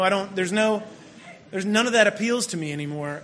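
ordinary delivery

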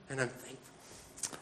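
slow breathy whisper of thanks; almost whisper it, slowly. Real, not acted. Quiet whispered thanks before prayer.

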